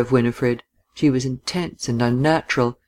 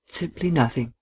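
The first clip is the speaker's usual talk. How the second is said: soft, understated answer; quiet reply; 6 dB quieter than usual. A narrator's reading. Man answering her question, quietly. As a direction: answer softly, giving little away. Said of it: "he replied quietly".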